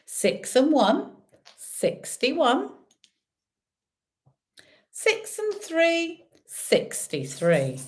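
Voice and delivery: drawn-out bingo number call; bingo caller. Acted. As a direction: call the numbers slowly, rising then falling.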